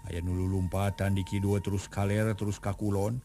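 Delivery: usual speaking voice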